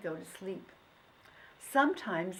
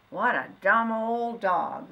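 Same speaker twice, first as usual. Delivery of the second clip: slow, louder mocking farmer; scornful farmer; 2 semitones higher, 8 dB louder, slower than usual. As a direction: shake your head at the dog. Farmer gives up hunt.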